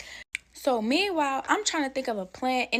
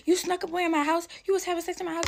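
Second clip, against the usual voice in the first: angry mother; 8 semitones higher, faster than usual. Retold or imitated. Mother accusing her on phone.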